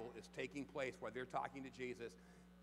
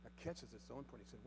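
ordinary talk, nothing marked

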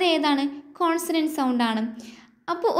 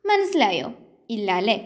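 ordinary talk, nothing marked

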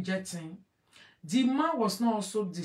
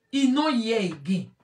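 normal talking voice

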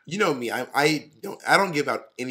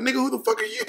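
sassy comeback; 12 semitones higher, faster than usual. Retold or imitated. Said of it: "And I'm like".

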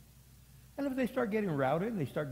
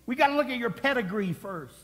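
mock snob; 9 semitones higher, 5 dB louder than usual. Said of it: "We didn't say".

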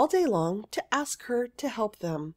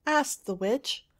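plain reading voice

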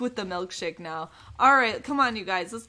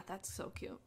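soft whispered admiration; 3 semitones lower, 16 dB quieter than usual. Real, not acted. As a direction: breathe it out in a whisper. Quiet aside admiring the look.

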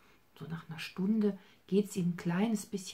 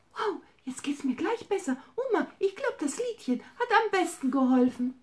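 high, louder, delighted bunny voice; happy bunny; 12 semitones higher, 7 dB louder than usual. Acted. Healed bunny Hops thanks grandma, high and delighted. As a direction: burst out with joy.

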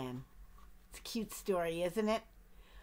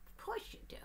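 dry whisper under the breath; whispered aside; 12 semitones higher, 11 dB quieter, faster than usual. Acted. Narrator whispering a joke to the child listener.

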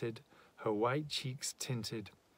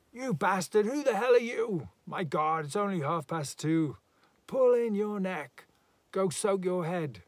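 loud, high shouted insults; angry heckling; 8 semitones higher, 8 dB louder than usual. A narrator's reading. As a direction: hurl abuse across at a neighbour. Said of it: "among the more polite things said".

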